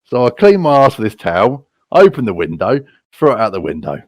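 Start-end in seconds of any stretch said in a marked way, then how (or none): none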